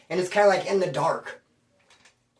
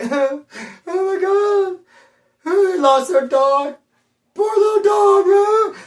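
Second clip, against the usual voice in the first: building to a much higher, louder mock wail; hammed-up wailing; 12 semitones higher, 5 dB louder, slower than usual. Crying at a lost dog poster, hammed-up wail. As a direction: overdo the tears until it's a wail. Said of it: "I'll cry and get all emotional".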